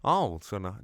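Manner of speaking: ordinary talk, nothing marked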